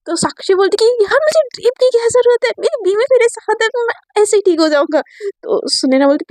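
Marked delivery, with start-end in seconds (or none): 0.9-5.0 carefree delight. A narrator's reading. Sakshi carefree, dancing.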